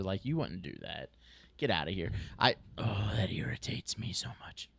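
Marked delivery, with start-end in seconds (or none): 1.6-4.7 exasperated whisper. Real, not acted. Annoyed aside at bad behaviour.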